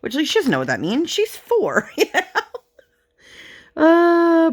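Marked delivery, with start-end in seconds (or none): 1.1-4.5 high laughing words. Real, not acted. Mother telling viewers her daughter is four, laughing high. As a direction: laugh as you say it.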